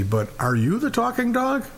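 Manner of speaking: high, climbing acted question; incredulous question. Visitor leans over to the mangy dog, incredulous question.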